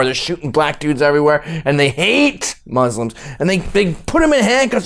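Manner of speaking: ordinary talk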